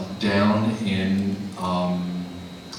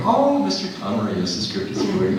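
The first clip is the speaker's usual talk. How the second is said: high, polite front-desk voice; chirpy receptionist pitched up; mock receptionist; 10 semitones higher than usual. Imitating receptionist.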